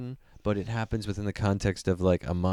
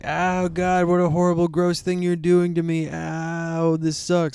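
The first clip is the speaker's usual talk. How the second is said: loud, higher, overdone complaining; mock torture victim; 8 semitones higher, 6 dB louder than usual. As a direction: ham up a gore victim's pain. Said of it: "It's kinda like".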